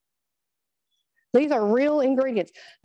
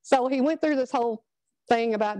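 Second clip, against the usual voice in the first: ordinary delivery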